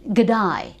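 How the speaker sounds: "g'day" with day sounding like die; Australian vowel. Shown as a demonstration. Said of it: "rather than day".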